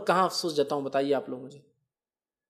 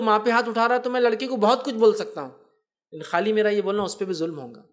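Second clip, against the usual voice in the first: ordinary delivery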